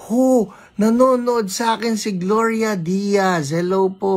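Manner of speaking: high, thrilled exclamation; starstruck excitement. Real, not acted. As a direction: marvel at a famous viewer. Starstruck by a famous viewer.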